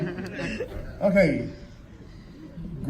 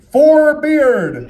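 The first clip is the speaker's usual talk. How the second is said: higher, louder call to the crowd; calling out loudly; 7 semitones higher, 8 dB louder than usual. Real, not acted. Calling names for applause vote.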